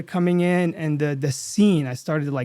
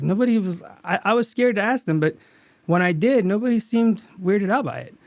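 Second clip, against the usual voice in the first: usual speaking voice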